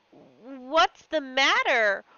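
slow, anxious "What's the matter?" with a fall on "matter"; worried question. Acted. Husband seeing his wife weep, slow and anxious. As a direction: ask it slowly, worried. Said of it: "he stuttered".

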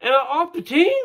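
high, loud whining question; whiny plea. Retold or imitated. Pitcher whining to the coach about being cut, high whine. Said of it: "he kind of whined".

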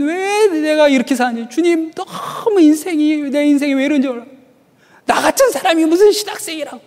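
high-pitched, mock-anguished lament; drunken wailing prayer. Drunk seminary student despairs of himself in prayer, wailing. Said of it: "기도가 아니라 술주정이에요".

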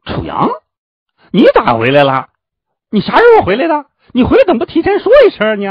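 high, loud squawk of joyful surprise; delighted surprised shout. Acted. Noisy disciple greets his returning friend, delighted shouting. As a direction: shriek excited questions in a high voice, racing at the end. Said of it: "惊喜地叫出声来".

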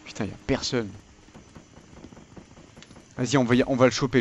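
ordinary talk, nothing marked

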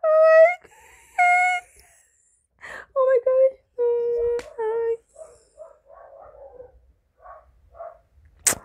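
high crying wail, fading away at the end; sobbing wail. Retold or imitated. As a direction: wail it like you're still sobbing over the finale. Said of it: "I was crying really, really hard".

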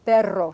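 "Perro" with a strongly rolled R; trilled Spanish R. Shown as a demonstration. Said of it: "That's that trilled R".

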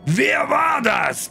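the forest king roars at the guests to find the culprit; roaring king. A narrator's reading. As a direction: bellow it at the whole hall as the furious king. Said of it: "brüllte der König".

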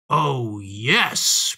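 gloating storybook fox, drawn out; crying out. A narrator's reading. Fox answers the frightened piglet, crying out gleefully. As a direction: relish catching the prey. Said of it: "cried the fox".

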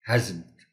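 "hasn't" said as one joined word; model pronunciation. Shown as a demonstration. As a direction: say "hasn't" clearly as a contraction. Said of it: "it will be pronounced as". ("hasn't" کا تلفظ)

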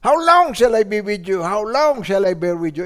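loud, driving sermon voice; fiery preaching. Real, not acted. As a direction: preach it full force. Preaching at full force.

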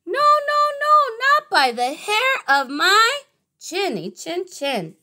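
high squeaky little pig, refusing. Acted. Little pig refusing the wolf at the door, squeaky and high.

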